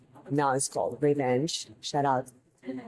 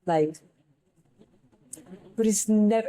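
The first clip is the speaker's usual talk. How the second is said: ordinary delivery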